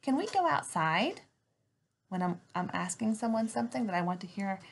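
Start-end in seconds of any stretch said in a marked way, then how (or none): 0.0-1.2 example question. Shown as a demonstration. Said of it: "If I said".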